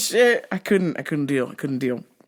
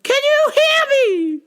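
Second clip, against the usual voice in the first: put-on very high, slow shouting old lady; old lady caller; 6 dB louder, slower than usual. Old caller yelling can you hear me, high and loud. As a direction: shout slowly into the phone.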